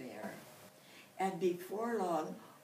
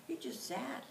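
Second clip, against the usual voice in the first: ordinary delivery, nothing marked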